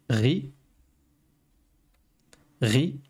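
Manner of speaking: "Ri" said as Arabic ghayn plus i; letter with kasra. Shown as a demonstration. Teacher shows learners the kasra vowel, demonstrated "Ri". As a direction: say "Ri" alone as a model syllable. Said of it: "je peux mettre une kasra et prononcer".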